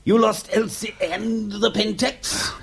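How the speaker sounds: high, incredulous outburst; can't believe what he's hearing; 1 aghast disbelief. Acted. Employer hears of the lost girl, aghast. Learns Elsie and camera lost.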